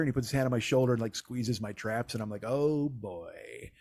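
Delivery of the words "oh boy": uneasy aside. Retold or imitated. Retelling the bartender squeezing his shoulder, quietly uneasy. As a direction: let it sink under your breath.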